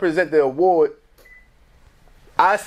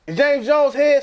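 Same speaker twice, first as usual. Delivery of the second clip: announcer calling out; 9 semitones higher, slower than usual. Retold or imitated. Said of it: "it's like".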